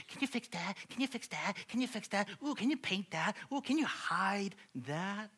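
high-pitched, rapid-fire nagging; eager pestering. Retold or imitated. Homeowner trails the handyman round the house, high eager pestering. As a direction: pester him like an over-keen homeowner.

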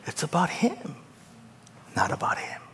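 soft, breathy whispered aside; hushed emphasis. Real, not acted. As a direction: whisper the point intimately. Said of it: "whispered point".

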